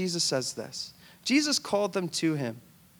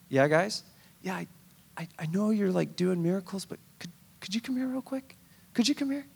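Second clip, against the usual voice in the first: 1 sheepish disciple. Acted. Disciples pestering Jesus.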